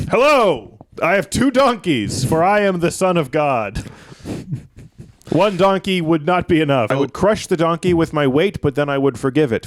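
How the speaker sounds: high, grand proclaiming voice; high pompous Son of God; pompous Jesus. Acted. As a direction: announce yourself like a royal. Pompous Jesus on two donkeys.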